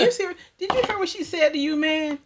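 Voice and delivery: high, indignant mock outrage; fuming husband. Wife voicing the husband's angry inner voice, high mock outrage. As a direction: voice the devil egging him on. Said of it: "he was fuming against me".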